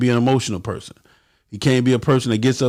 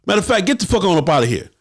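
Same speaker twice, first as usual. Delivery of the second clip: mock police; 7 semitones higher than usual. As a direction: boss the crowd back like a cop. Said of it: "the police tell you".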